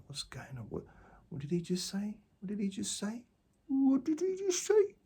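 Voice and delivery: high, thin voice, getting shriller with each repeat; mock the baffled listener, rising to a squeak; confused listener.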